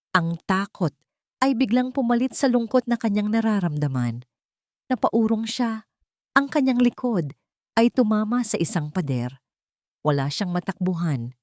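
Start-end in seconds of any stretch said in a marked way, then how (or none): none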